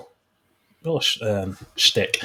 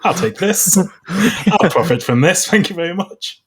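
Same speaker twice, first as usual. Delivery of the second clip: higher, louder mock opportunist; British colonizer; 8 semitones higher, 4 dB louder than usual. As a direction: grab it with cheeky, laughing entitlement. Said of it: "the British came along".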